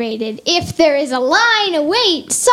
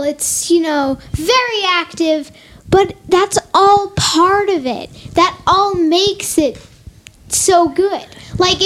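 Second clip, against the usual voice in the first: normal talking voice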